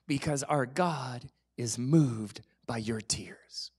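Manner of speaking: soft tender whisper, slow. Real, not acted. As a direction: breathe it to the listener as a secret. Tender point about God's compassion.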